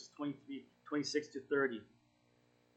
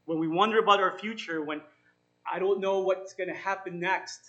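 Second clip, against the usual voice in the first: louder, urging preacher's voice; emphatic preaching; 5 semitones higher, 9 dB louder than usual. Acted. Pastor urging the struggling listeners, louder and emphatic. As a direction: press the promise on them.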